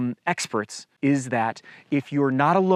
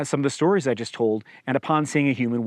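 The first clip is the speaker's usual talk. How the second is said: ordinary delivery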